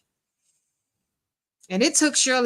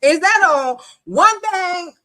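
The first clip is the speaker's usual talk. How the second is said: high, loud mock interrupting voice; high-pitched, hammed-up impersonation; Nesto; 10 semitones higher, 6 dB louder than usual. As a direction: butt in loudly with an exaggerated mimicked question. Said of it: "Nesto said".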